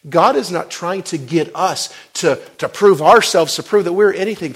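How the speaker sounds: high, loud fiery sermon delivery; full-force preaching. Acted. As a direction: preach it out, building to a roar.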